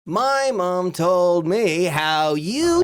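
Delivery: slow, high, loud taunt; vindictive brother tattling. Retold or imitated. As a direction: broadcast the secret with drawn-out relish. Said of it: "My brother Chris was very vindictive".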